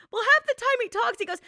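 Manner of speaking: ordinary talk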